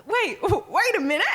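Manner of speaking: high, alarmed imitation; mock freak-out. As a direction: replay the moment of alarm. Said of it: "And I freaked out".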